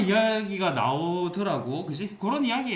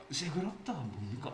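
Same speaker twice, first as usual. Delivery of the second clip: retold puzzled reply; 3 semitones lower, 10 dB quieter than usual. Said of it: "이런 식으로 이야기를 하더라고".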